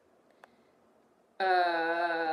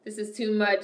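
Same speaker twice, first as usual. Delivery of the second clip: ordinary talk, nothing marked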